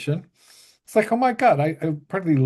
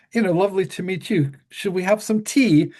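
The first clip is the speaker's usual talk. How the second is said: higher and quicker, a lightly voiced gracious hostess; sweet English lady; 6 semitones higher than usual. [name] greeting her fan, lightly voiced high sweet lady. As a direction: offer tea like a kindly English lady.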